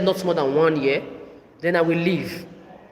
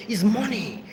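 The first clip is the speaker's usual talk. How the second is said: lightly voiced mother, clipped; mocking mother; 2 semitones higher, slower than usual. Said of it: "You see how I'm doing?".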